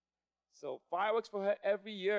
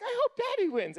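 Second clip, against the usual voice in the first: put-on much higher, squeaky little-kid chirp; tiny kid voice; 6 dB louder than usual. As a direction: pitch way up like the youngest child. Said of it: "Noah's like".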